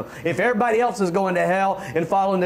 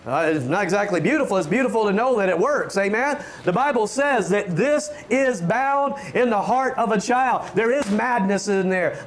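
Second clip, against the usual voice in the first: normal talking voice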